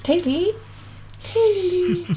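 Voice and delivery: high calling voice. Real, not acted. Puppet calls for Tilly to come on stage, calling. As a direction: call out for her as if she's nearby.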